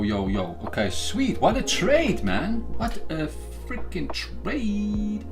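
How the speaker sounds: ordinary delivery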